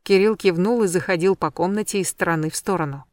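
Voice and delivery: ordinary delivery, nothing marked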